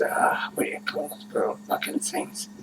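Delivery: unvoiced muttered aside; whispered aside. Real, not acted. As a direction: whisper it to yourself. Mumbled aside to himself.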